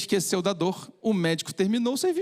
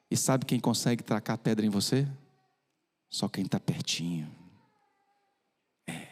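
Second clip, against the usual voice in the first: intimate preaching; 10 semitones lower, 3 dB quieter than usual. Acted. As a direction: speak close to the congregation. Low, intimate preaching application. (pregação baixa e íntima)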